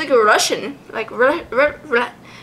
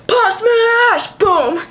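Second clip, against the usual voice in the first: high, loud battle shout; high, loud creature battle cry; voicing the Pokemon; 9 semitones higher, 3 dB louder, much slower than usual. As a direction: yell the attack like a cartoon brawler. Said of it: "It's like".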